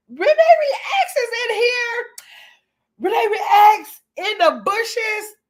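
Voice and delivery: high, slow squeal of delight; excited greeting. Real, not acted. Host squealing to a viewer who just joined, delighted.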